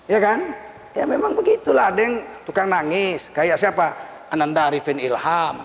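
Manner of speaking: ordinary delivery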